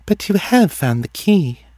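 high, detached oracle voice; distant. A narrator's reading. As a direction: pitch it high and far away, as if dreaming.

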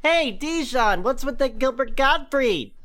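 high, slow, teasing question; mock commenter. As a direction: call out to Dijon teasingly. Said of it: "go down every single one and go".

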